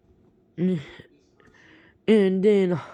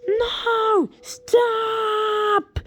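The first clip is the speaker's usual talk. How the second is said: very high, pleading mock yell. Boy hit by flying meatballs, very high pleading yell. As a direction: cry out for it to stop. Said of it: "and I was yelling".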